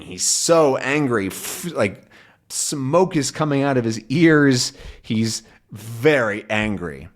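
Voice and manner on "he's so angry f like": ordinary delivery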